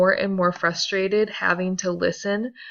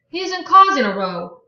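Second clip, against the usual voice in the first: higher and louder plea; desperate Daisy; 8 semitones higher, 9 dB louder than usual. Acted. Daisy defends Gatsby against Tom, high and desperate. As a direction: defend him in a rush of alarm.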